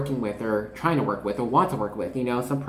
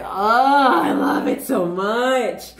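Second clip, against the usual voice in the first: gushing; 9 semitones higher, 4 dB louder, much slower than usual. Real, not acted. Gushing over tarot card.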